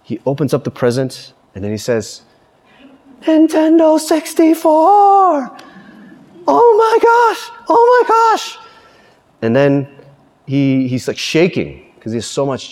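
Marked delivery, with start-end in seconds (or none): 3.2-5.6 long high-pitched mock squeal; ecstatic kid. Preacher imitating the boy in the video unwrapping his present, ecstatic. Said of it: "this is what he literally does". 6.5-8.6 very high, drawn-out squealed exclamations; ecstatic kid. Retold or imitated. Preacher imitating the boy shaking with joy, ecstatic. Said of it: "this is what he literally does".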